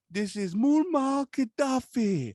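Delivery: high, slow put-on foreign dictator voice; Muammar Gaddafi prank voice. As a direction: ham up a menacing prank-call dictator. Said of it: "So I call this dummy and I'm like".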